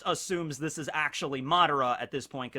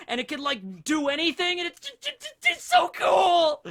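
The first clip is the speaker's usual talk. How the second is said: much higher and louder, breathless; excited gushing; 12 semitones higher, 5 dB louder than usual. Real, not acted. Fan listing Shisui's powers, squealing excitement. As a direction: rave about him, squealing.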